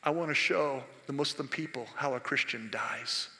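very low, hushed, solemn voice; God. Retold or imitated. God answering a dying missionary's prayer, hushed and solemn. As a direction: drop right down, soft and grave, with long pauses. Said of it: "God said".

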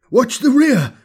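high, loud urgent shout; shouted warning. A narrator's reading. Lachius warning comrades as shapes close in, shouted. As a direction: yell it out as an alarm. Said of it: "Lachius shouted".

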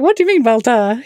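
high, incredulous question; indignant surprise. Retold or imitated. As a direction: act baffled and a little offended. Indignant retort to husband.

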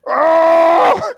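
loud high surprised oh; startled shout. Retold or imitated. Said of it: "And she goes".

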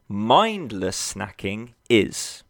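Mindless drawn out and slow; stress on mindless. Shown as a demonstration. Coach correcting clients' view, leaning on Mindless. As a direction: hang on Mindless before snacking. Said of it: "snacking is not a bad thing".

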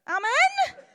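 high, loud call to the room; call for amen. Real, not acted. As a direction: rouse the congregation. Preacher calls for response.

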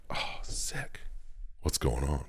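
whispered, half under breath; quiet awed whisper to yourself; hushed whisper. Retold or imitated. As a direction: breathe it out in awe. Quoted hushed reaction in game.

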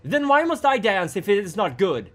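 high, loud, impulsive question; blurted challenge. A narrator's reading. Raya speaking up to the crowd before stopping herself, blurted challenge. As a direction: blurt it out before you can stop. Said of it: "speaking up before she could stop herself".

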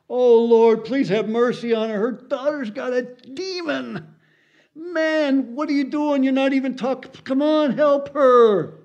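very high, loud mock pleading; hammed-up high-pitched begging; pleading disciples. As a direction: cry out in exasperated appeal, voice way up high. Acted disciples pleading with Jesus.

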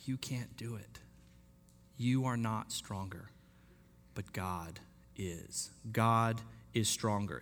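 soft and slow, almost a whisper; hushed, slow letter voice. Acted. Paul reassuring defeated believers, hushed and slow. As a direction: let the words sink in quietly.

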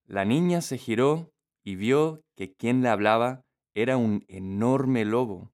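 ordinary delivery

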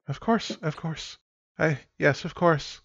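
high, anxious, hurried agreement; nervous girl. Acted. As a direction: squeak out a nervous yes to the scout. Nervous Carla answers scout.

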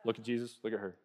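hushed, giggly aside; gossiping murmur. Retold or imitated. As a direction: nudge your neighbor about the scene. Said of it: "everyone's like".

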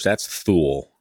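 Thule as THOOL; American Thule. Shown as a demonstration. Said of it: "In Eng-, American English".

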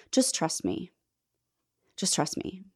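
put-on Jesus softly reassuring a little girl; gentle reassurance. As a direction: coax her to let go.

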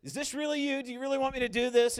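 half-mocking desperate questioning; pleading with God. Preacher acting a believer begging God for a sign. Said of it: "Where you're like".

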